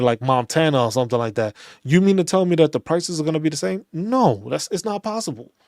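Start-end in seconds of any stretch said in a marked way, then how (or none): none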